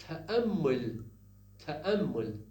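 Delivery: تأمل with a clear glottal catch before the m; glottal stop in تأمل. Shown as a demonstration. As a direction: stress the hamza in تأمل. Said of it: "When say".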